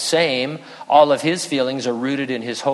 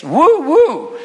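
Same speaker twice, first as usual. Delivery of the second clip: very high, loud whooping; high loud woo-woo; excited whoop; 12 semitones higher, 6 dB louder than usual. Retold or imitated. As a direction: whoop like a smitten owl. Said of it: "Owl says".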